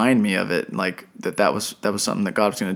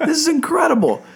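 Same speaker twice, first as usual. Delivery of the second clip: high, thrilled outburst; giddy, high-pitched awe; ecstatic amazement. Retold or imitated. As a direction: gush in high-pitched elation, reliving the moment. Retelling joyful reaction to offer.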